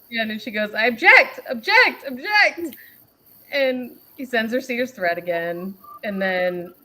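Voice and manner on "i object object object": high, loud, repeated outburst; mock courtroom objection. Reading her objections to the troll, mock outburst. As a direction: hammer out each objection like a mock lawyer.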